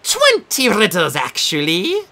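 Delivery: high, slow gloating host; gleeful. Acted. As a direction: relish the bad news. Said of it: "the Sphinx said gleefully".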